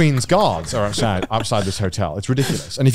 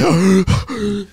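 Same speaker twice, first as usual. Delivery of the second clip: mock gasp; 8 semitones higher than usual. Reacting to menu prices.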